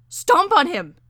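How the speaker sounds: high, loud scream; frantic full-voiced yell; screamed command. A narrator's reading. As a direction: scream the order in a frenzy. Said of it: "Clytie screamed".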